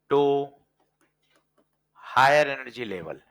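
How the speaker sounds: very slow, each word spaced out; slow dictation. Shown as a demonstration. Teacher dictating as he writes it on the board. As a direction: spell it out at writing speed. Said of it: "देखो मैं लिखता हूं फिर बताना".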